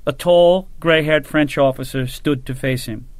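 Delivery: ordinary delivery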